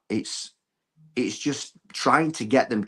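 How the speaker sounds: ordinary delivery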